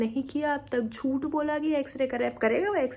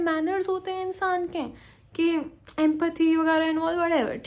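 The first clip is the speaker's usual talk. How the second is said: outraged retold lecture; 5 semitones higher than usual. She lectures the delivery men on manners in outrage. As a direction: scold them like they lack basic manners.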